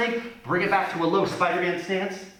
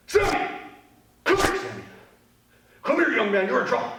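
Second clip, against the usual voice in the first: mad parent; 8 semitones higher, faster than usual. Shown as a demonstration. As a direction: scold like a mom who's had it. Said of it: "they're just a little bit mad".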